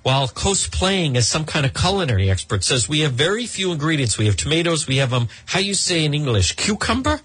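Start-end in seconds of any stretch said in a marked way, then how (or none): 2.9-7.2 mock Spanish accent. Host mocking Hilaria's Today Show clip, in a put-on Spanish accent. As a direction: imitate Hilaria faking a Spanish accent, rising on cucumber. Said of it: "speaking in a Spanish accent".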